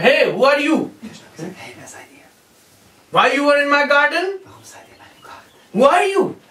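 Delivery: high, loud demanding call; commanding child's challenge. Acted. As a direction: call out at strangers in your garden. Krishna challenges strangers in garden.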